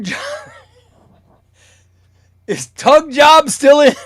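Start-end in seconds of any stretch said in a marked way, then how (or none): 2.5-4.1 laughing shout. Real, not acted. Laughing at a silly username.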